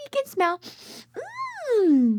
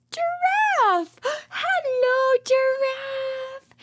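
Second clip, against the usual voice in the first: thrilled big reveal; excited reveal; 8 semitones higher, much slower than usual. Acted. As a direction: burst out with the surprise.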